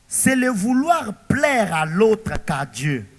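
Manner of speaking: ordinary talk, nothing marked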